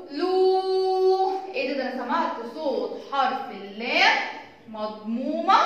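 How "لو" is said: لو held as a long "luuu"; drawn-out لو; long vowel. Shown as a demonstration. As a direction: sing لو on a steady higher note. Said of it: "طويلة".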